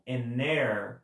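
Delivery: AN HEIR drawn out as "a-NAIR"; silent H. Shown as a demonstration. Teacher repeating the example, slow silent H. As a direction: slowly link AN into HEIR without H. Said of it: "porque esse H é mudo".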